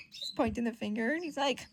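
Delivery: plain reading voice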